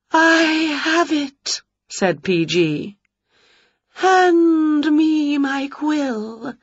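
high quavering slow spook, tearful; a precious ghost seeking inspiration; fussy ghost. Acted. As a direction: sigh and swoon over each word.

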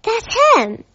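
high sharp accusing call; accusing shout. Acted. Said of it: "someone shouted".

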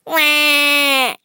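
loud, high, sustained "wah"; held storybook crying noise; mock baby wail. A narrator's reading. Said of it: "cried Chucky".

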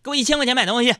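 imitating a wife's brisk order; short, peremptory mock command. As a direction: demand it like a bossy wife. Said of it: "用命令的语气跟我说".

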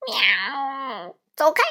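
cat yowling at being stepped on; mock angry meow-shout. A narrator's reading. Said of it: "猫生气的大叫". (生气的猫叫)

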